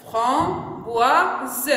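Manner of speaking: "Framboise" broken into fram, boi, se; syllable by syllable. Shown as a demonstration. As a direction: sound out "Framboise" with gaps, voicing the final e. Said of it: "on a combien de syllabes?". (« Framboise » découpé en trois syllabes, fram-boi-se)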